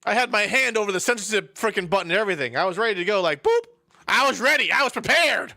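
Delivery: exasperated, high and loud complaining; real loud protest. Real, not acted. Player protests missed censor button.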